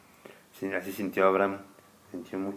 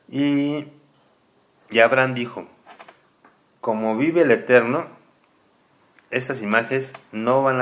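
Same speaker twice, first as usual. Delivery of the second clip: reading scripture aloud, louder and slower, solemn storyteller; lectura solemne; 2 semitones higher, 8 dB louder, slower than usual. Acted. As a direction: read it like sacred text to a child. (lectura solemne en voz alta, más fuerte y pausada)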